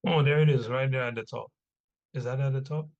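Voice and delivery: normal talking voice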